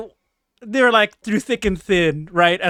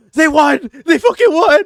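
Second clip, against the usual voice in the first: much higher, louder joyful yell; excited shout; 9 semitones higher, 7 dB louder than usual. Retold or imitated. Fan reading the result, much higher and louder shout. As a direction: shout the news with glee. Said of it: "I went".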